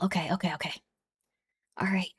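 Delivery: whispered nervous okays. Real, not acted. Streamer whispering to herself before joining the call, whispered. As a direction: psych yourself up under your breath.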